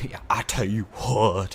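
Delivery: loud folksy Southern twang; classic Southern accent. Retold or imitated. Mimicking how the genius sounds to people, Southern accent. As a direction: exaggerate an everyday Southern accent. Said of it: "a classic Southern accent".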